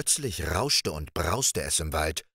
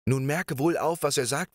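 calling to him; 6 semitones higher than usual. Acted. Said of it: "rief die schöne Frau dem Jüngling zu".

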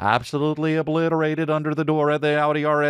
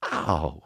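mock pained gasp; 9 semitones lower, 5 dB quieter than usual.

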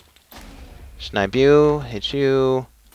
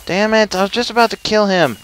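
quick, high-pitched frustrated complaint; 8 semitones higher than usual. Real, not acted. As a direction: complain as the kill slips away. Just missed a kill in game.

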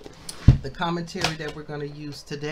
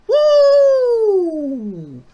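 a high, loud joyful woo; excited whoop; 13 dB louder than usual. Real, not acted. Teacher thrilled by the commentary, loud whoop. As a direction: whoop out loud with delight over the verse. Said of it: "I get excited".

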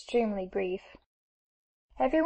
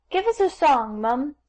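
obedient request; 4 semitones higher, 4 dB louder than usual. A narrator's reading. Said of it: "said the obedient guest".

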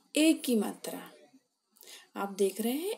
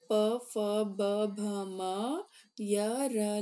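normal talking voice

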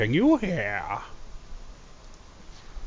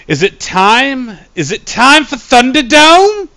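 much higher and louder announcer build-up; hype; 13 semitones higher, 8 dB louder than usual. Real, not acted. Streamer announcing Thunderdome to chat, hyped. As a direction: hype the crowd for the main event.

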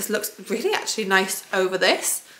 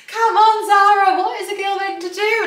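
high, loud exasperated plea; 11 semitones higher, 7 dB louder than usual. Real, not acted. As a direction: throw up your hands at the brand.

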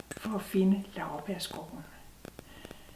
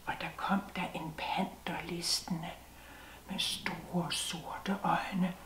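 soft, hushed whisper building dread; suspense whisper; 2 semitones lower, 5 dB quieter than usual. A narrator's reading. Narrator whispers to listeners as the panther creeps close.

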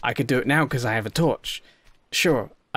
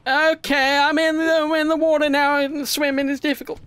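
tense high voice; 13 semitones higher than usual. Real, not acted. Swimming near shark.

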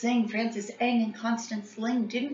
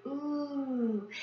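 ooh; 7 semitones higher, 9 dB quieter than usual. A narrator's reading. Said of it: "Everyone said".

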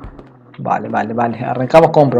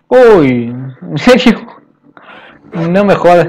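surprised; 3 semitones higher, 5 dB louder, slower than usual. Real, not acted. Sees new animals in the house.

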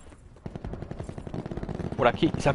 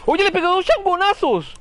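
excited; 9 semitones higher than usual. Real, not acted.

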